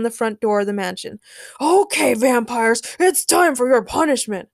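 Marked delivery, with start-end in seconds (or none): 1.6-4.5 mild acted shout, raised voice; hammed-up action hero threat; shouted announcement. A narrator's reading. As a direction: announce the showdown to the whole room. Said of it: "Frankencastle shouted".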